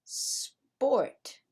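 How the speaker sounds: "Sport" starting straight on the S, no vowel; long hissed S opening "Sport", no E before it; clean initial S. Shown as a demonstration. Teacher models the word for Spanish speakers, clean initial S. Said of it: "it's not esport. It's sport".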